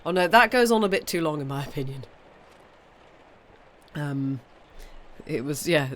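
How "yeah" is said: normal talking voice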